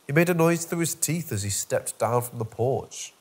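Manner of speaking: usual speaking voice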